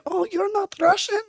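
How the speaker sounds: put-on high, slow, Russian-accented surprise; surprised Russian accent. Imitates Katrina's surprise, in accent.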